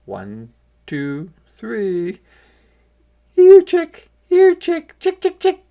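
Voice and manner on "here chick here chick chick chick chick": put-on high, loud girl's call; little girl calling. A narrator's reading. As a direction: call the chicks to the grain. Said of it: "called a little girl".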